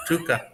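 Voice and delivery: "Tuca" said with no L sound, just TOO-ka; dropping the L. Shown as a demonstration. Husband testing a silent-L Toluca, tentative. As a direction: try the name with a silent L, "Tuca". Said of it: "the L is s-, probably silent".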